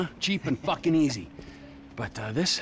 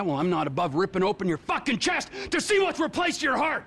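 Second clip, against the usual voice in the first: higher, shouted threat building through the line; furious threat; 6 semitones higher than usual. Acted. Threatening to rip the friend's chest open, furious. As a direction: rage at him, voice climbing. Acted quarrel, violent threat.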